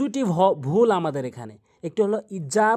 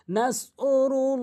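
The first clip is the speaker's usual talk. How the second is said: Nasrullah chanted slowly, higher, last vowel held; stretched madd; 5 semitones higher, much slower than usual. Shown as a demonstration. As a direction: draw out the end of Nasrullah on a raised note. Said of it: "এক আলিফ করে টেনে পড়বেন।".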